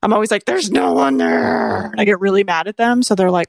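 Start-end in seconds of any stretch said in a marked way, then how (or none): 0.4-1.9 very low, drawn-out growl. Retold or imitated. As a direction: growl it deep and slow at the dogs. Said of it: "I growl at them like that".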